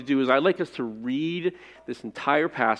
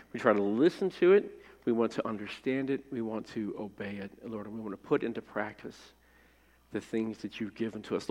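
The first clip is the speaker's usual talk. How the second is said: hushed, earnest prayer voice, much softer toward the end; 3 semitones lower, 3 dB quieter than usual. Real, not acted. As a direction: pray quietly before the congregation. Pastor leads prayer.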